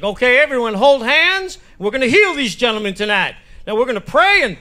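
high, loud revival-tent rallying; faith-healer preacher. Acted. Preacher commanding the tent to pray, fervent and loud. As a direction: belt it out to the congregation. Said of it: "And then the preacher's like".

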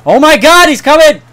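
high, loud frightened yell; panicked scream. Real, not acted. Gamer seeing a grasshopper charge him, panicked scream. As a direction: shriek as the bug charges.

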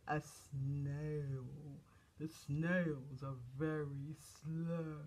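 very slow, low, drawn-out words; low, dragging storytime delivery; snail-slow. Acted. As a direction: stretch every word at a snail's pace. Explains slow snail, mimicking slowness.

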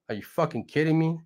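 ordinary delivery

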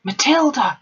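high, loud shouted name; roared name. A narrator's reading. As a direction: roar the girl's name across the room. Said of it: "she roared".

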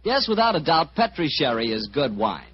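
ordinary delivery

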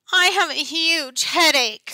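high, whining plea; whiny kid. A narrator's reading. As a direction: whine to get out of class. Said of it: "she whines".

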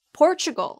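high-starting Portugal as "POR-chuh-gul"; teacher's English model of Portugal; corrected pronunciation. Shown as a demonstration. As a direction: hit POR in Portugal, drop away after. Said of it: "포르투갈 아니에요".

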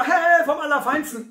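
baffled "Hä?" of confused people; puzzled. Retold or imitated. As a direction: sound puzzled, as if it makes no sense. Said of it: "dann sagen die Leute immer".

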